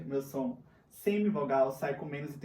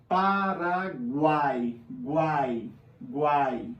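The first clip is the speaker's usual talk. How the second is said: "Paraguai" bem lento, depois "guai" isolado duas vezes; tritongo sem separar; 4 dB louder, much slower than usual. Shown as a demonstration. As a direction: silabe "Paraguai" e destaque "guai" numa só sílaba. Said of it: "se eu tenho três vogais e não separo". ("Paraguai" silabado, repetindo "guai" inteiro)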